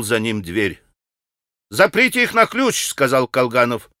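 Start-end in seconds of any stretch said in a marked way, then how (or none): 1.7-2.9 put-on high-pitched young-gentleman order; higher, lighter quoted voice; calling out. A narrator's reading. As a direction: call the order out in a raised pitch. Kolganov calls out an order.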